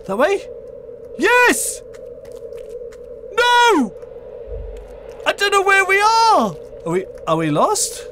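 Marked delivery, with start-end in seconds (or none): 0.1-6.5 panicked yelling while fleeing; being chased. Real, not acted. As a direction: scream it, running for your life. Fleeing a monster in game.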